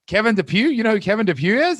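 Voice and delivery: high, fast, amazed double-take; amazed disbelief. Retold or imitated. To the sound guy who knows Kevin, a high amazed double-take. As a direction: ask it like you can't believe your ears.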